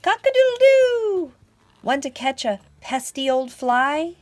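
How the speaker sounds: very high, loud crow; piercing rooster wake-up call; storybook rooster. Acted.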